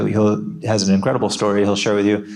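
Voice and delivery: ordinary delivery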